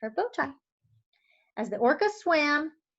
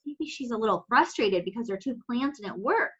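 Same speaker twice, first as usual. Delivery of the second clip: ordinary delivery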